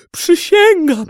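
high, loud desperate oath; frantic babbling. A narrator's reading. Captive swearing to the sultan, desperate high cry. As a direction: cry out the oath, falling at the end. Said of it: "bełkotał Orbano".

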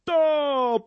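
a short high squeak. A narrator's reading. Brzytwa squeaks to the crowd, high. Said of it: "pisnął Brzytwa".

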